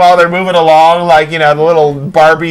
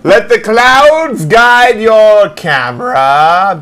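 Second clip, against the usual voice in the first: dramatic slogan read; 3 semitones higher, much slower than usual. Acted.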